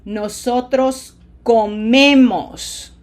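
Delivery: "comemos" slow, with a loud, long "me"; stress on second syllable. Shown as a demonstration. Teacher models the verb for students, stressing "me" in "comemos". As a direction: lean hard on the "me" in "comemos". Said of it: "second syllable is me instead of ko".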